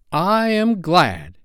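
high, drawn-out joyful relief; slow, elated high exclamation; glad cry. A narrator's reading. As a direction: cry out with delight. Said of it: "Peter cried".

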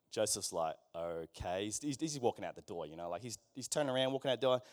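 low, quiet and deflated; lightly voiced stunned Joseph; stunned mumble. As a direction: mumble it like a man in shock.

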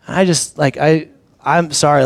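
ordinary talk, nothing marked

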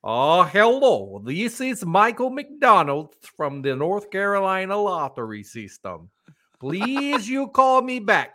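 slow, high mock Russian caller; hammed-up Russian voicemail voice; Russian accent. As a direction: drag it out in a thick Russian accent. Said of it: "like, a Russian accent".

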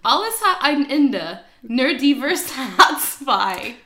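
slow German phrase, laughing at the end; slow German demo. Shown as a demonstration. Teacher slowly reading her friend the German proverb. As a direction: model "Alles hat ein Ende, nur die Wurst hat zwei" slowly. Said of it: "the phrase is".

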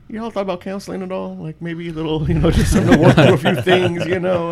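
high, tentative voice breaking into laughter; mock delicate suggestion. Cousin teasing about family counseling, mock delicate suggestion.

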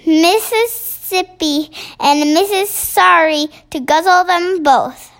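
quoted giants' yell. Acted. BFG mimics the giants going past, mock yell. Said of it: "they was yelling".

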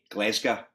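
"Glesga" as locals say it, GLEZ-guh; local Glaswegian pronunciation. Shown as a demonstration. Pastor showing what locals call the city, Glaswegian "Glesga". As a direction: Glasgow shortened to a local "Glesga", e in the first syllable. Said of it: "locals here in the city refer to".

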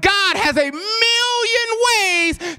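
high, slow, sung preaching cry; preaching whoop. Acted.

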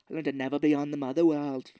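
mock accent mangled by bad teeth; trouble talking through teeth. Recapper mocking actor hampered by silver teeth, mangled speech. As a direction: talk as if your teeth get in the way. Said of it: "The way he's talking, it's like it's giving him problems".

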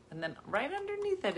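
usual speaking voice

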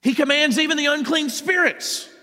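high-pitched, astonished exclamation; amazed. A narrator's reading. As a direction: marvel aloud with the voice lifted right up. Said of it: "they were all amazed".

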